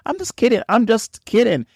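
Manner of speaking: high, breezy mock reassurance; sarcastic. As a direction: brush off the jab as a joke, high and light. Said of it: "That's being sarcastic".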